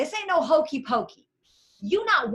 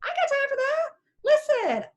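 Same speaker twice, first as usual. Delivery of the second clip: sassy; 14 semitones higher than usual. Real, not acted. Sassy brush-off of quitters.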